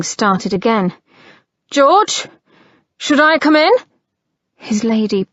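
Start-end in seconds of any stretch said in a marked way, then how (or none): 1.7-3.7 calling out. Acted. Brother calling through the door.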